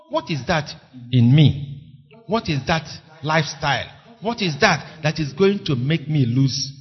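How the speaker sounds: ordinary talk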